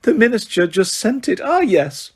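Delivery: high, sugary correction; simpering. Acted. Umbridge correcting McGonagall, simpering. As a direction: simper the name.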